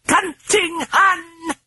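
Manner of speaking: very high, slow shrieking British voice; hoarse British shriek. A narrator's reading. The British voice cursing the Hun. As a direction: screech the insult hoarsely, British. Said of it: "shrieked in the hoarse British accented voice".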